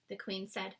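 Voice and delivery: plain reading voice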